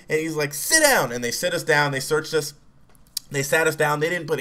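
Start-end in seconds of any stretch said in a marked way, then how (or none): 0.5-1.1 high-pitched cop command; angry order. Retold or imitated. Angry cop ordering boys to sit, imitated sharp command. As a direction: order him down as the worked-up cop.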